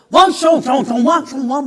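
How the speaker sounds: loud barking nonsense syllables; tongues babble. Retold or imitated. Father babbling in tongues, loud mock babble. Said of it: "막 이래요".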